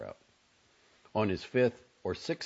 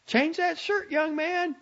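high, loud motherly order; mother's high-pitched parting shout; calling back; 5 dB louder than usual. A narrator's reading. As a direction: call it over your shoulder, high and commanding. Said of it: "to call back over her shoulder".

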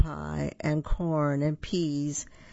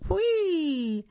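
squealing whee; 13 semitones higher than usual. Acted. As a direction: shriek with joy riding the cart. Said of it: "squealed Duck".

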